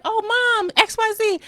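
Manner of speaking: put-on high bright girlish greeting; imitating daughter. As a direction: voice the daughter chirping to mom. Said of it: "she was like".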